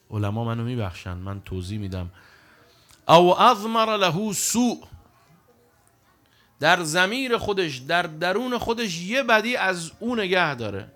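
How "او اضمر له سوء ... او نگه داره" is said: loud, slow, higher emphasis; forceful preaching. Acted. Preacher explaining the hadith to the congregation, loud and slow. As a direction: drive the point home slowly.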